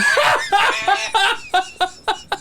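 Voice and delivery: high, shouted laugh. Real, not acted. Reacting to the saved Snapchat fart, a high shouted laugh.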